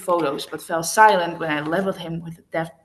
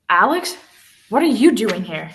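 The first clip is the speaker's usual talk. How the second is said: startled, louder cry of surprise; alarmed; 2 semitones higher, 7 dB louder than usual. A narrator's reading. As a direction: caught off guard, blurt it out. Ava alarmed at seeing Alex.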